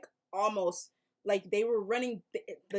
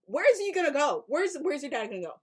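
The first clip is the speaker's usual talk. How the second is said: loud, high rhetorical question, easing off; exasperated scolding; 5 semitones higher, 7 dB louder than usual. Retold or imitated. Viewer lecturing the boy about fleeing zombies, exasperated scolding. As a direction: demand it of the clueless kid.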